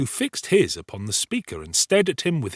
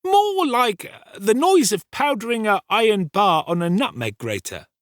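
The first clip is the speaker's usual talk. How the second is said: much higher, louder character voice; eager young Brittles; 11 semitones higher, 5 dB louder than usual. Acted. Brittles offering his description of the noise, eager and high. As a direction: pipe up high with a suggestion.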